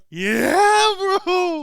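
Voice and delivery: high, drawn-out exclamation; excited cry. Real, not acted. Host agreeing with co-host that it's her, high cry. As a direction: wail it out in emphatic agreement.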